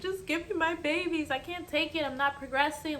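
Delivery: high, pleading voice, retelling her tearful begging; pleading through tears. Retold or imitated. Mother in labor begging the doctor, tearful plea. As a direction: beg for the babies as if crying again.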